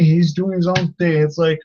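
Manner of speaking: ordinary talk, nothing marked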